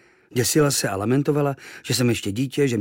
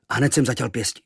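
ordinary delivery, nothing marked

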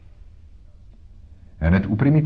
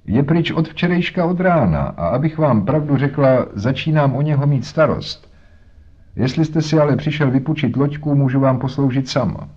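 higher, folksy woman's voice; worried wife talking plainly to a customer; chatty boatman's wife; 7 semitones higher than usual. A narrator's reading. (ustaraná prostá žena)

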